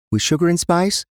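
put-on high little-girl voice, rising at the end; young daughter, asking. Daughter asking dad for their bedtime phrase, high child voice.

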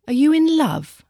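high suspicious jealous question. A narrator's reading. Tom asks his friend suspiciously if she is in love. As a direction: probe her with jealousy. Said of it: "in a suspicious, jealous tone".

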